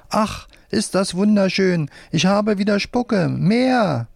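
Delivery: high, thrilled puppet voice; delighted outcry. A narrator's reading. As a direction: cry out in delight, pitched high. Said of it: "Die schreit auf".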